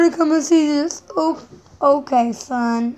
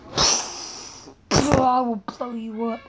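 soft, slow, lowered monster threat; mad voice; 4 semitones lower, 7 dB quieter, much slower than usual. Pretend. Child threatening Mama in his mad voice, slow and soft. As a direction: draw it out slowly like a menacing monster. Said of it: "You see my mad voice".

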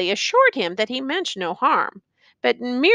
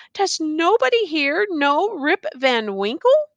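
mild despair, pitched higher; despairing cry; 6 semitones higher than usual. A narrator's reading. Rip asks the crowd after himself, mildly despairing. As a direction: plead with a touch of despair, rising at the end. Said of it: "cried out in despair".

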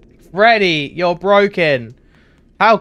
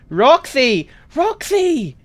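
calling out; 9 semitones higher, slower than usual. Real, not acted. Calling out to game character.

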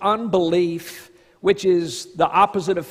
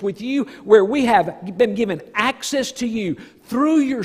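louder, higher, fervent preaching voice; preaching at full force; 4 semitones higher, 5 dB louder than usual. Acted. Pastor exalting God before the congregation, fervent and loud. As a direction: lift it up with rising passion.

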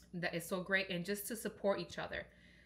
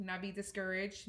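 ordinary delivery

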